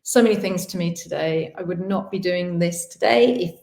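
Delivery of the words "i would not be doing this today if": ordinary delivery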